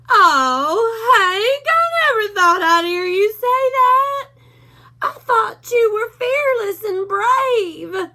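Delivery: a timid pup whimpering to his boss; scared Drover. A narrator's reading.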